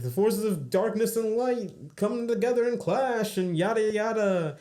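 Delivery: put-on high-pitched old-time newsreel announcer; 1920s announcer. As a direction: proclaim it like a 1920s radio announcer. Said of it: "In the nineteen twenties announcer voice".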